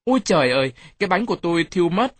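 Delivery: high, loud mock alarm; loud shout. A narrator's reading. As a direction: burst in wailing over a ruined pancake. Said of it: "hét lớn". (hét lớn, kêu trời đầy kịch tính)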